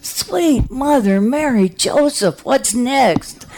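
high, slow desperate plea; panicked prayer. Retold or imitated. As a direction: pray high and slowly. Panicked prayer during heart attack.

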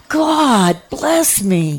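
high pleading. Retold or imitated. Same words earlier whispered.

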